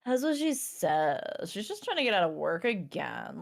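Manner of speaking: ordinary talk, nothing marked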